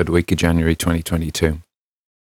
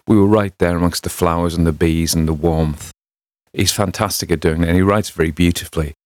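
plain reading voice

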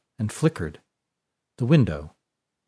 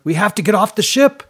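high, loud pleading shout; urgent shout; 9 semitones higher, 5 dB louder than usual. A narrator's reading. Brody chasing Fiona, shouting urgently. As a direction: shout after her to stop.

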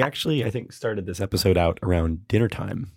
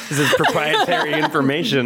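laughing; 5 semitones higher than usual. Real, not acted. Mock-serious joke while laughing.

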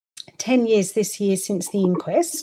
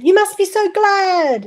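cheery well-wisher; 10 semitones higher, 5 dB louder than usual. Retold or imitated. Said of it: "people said".

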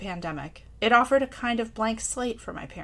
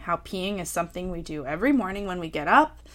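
ordinary talk

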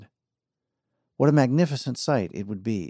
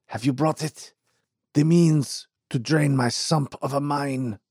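put-on eager old man; 5 semitones higher than usual.

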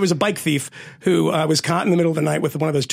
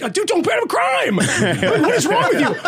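high-pitched mock scolding; retold exasperation; 10 semitones higher than usual. Telling inmate not to commit crimes, exasperated retelling. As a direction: plead incredulously, voice pitched way up.

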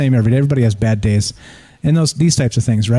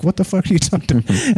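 half-laughing mock outrage, pitched up; mock indignant; 6 semitones higher than usual. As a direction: toss it off as an amused, baffled retort. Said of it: "I was like".

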